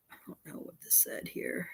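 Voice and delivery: a real breathy whisper to herself; whispered aside. Real, not acted. Whispering to herself over a text she can't read. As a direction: drop to a whisper, puzzled by the text.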